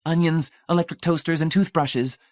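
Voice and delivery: high, clearly spoken list. A narrator's reading. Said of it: "Marcia said clearly".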